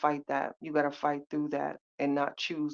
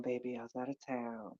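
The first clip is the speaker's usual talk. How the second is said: soft tender reply; 3 semitones lower, 9 dB quieter than usual. Real, not acted. Answering a chat viewer tenderly.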